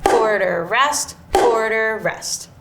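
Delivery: each note name placed squarely on the beat; steady rhythmic call, word by word; counting out notes in rhythm. Shown as a demonstration. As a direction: say the notes in time as you play. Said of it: "saying the type of note that I'm playing".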